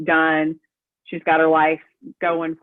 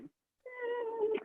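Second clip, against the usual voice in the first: skeptical hum; 14 dB quieter than usual. Retold or imitated. Teen daughter reacts to call.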